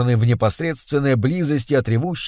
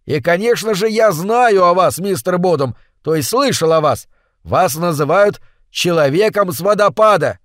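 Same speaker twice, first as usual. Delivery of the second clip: shouting; 4 semitones higher, 4 dB louder than usual. Acted. Shouting over waterfall noise.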